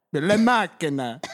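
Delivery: slow, showy Italian flourish; mock Italian. Shown as a demonstration. Showing how Italian says car, flowery Italian accent. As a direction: draw it out with florid Italian romance. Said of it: "look up how to pronounce car".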